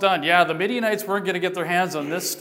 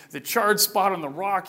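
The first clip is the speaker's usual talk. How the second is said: usual speaking voice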